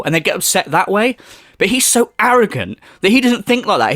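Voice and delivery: ordinary talk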